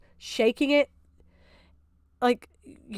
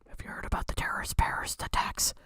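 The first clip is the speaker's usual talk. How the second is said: mock whisper; 10 dB quieter, faster than usual. Mocking the questioner in a creepy whisper. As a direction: drop into a sinister whisper.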